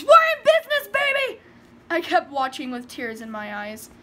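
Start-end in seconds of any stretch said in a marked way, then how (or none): 0.0-1.4 high, loud scream of joy; much higher, much louder cheer. Acted. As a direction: shout it out in triumph. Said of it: "I screamed".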